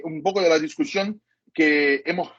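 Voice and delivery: ordinary talk, nothing marked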